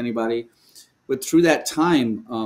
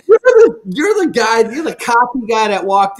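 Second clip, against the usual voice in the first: much higher, louder indignant jab; mock outrage; 10 semitones higher, 8 dB louder than usual. Retelling his angry thought to the host, high and loud. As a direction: throw it back at him.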